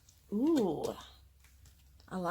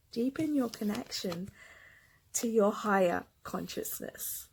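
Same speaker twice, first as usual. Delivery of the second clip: ordinary talk, nothing marked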